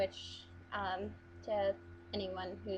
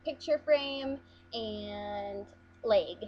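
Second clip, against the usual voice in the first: slow listing; 5 dB louder, much slower than usual. Shown as a demonstration. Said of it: "things around the room".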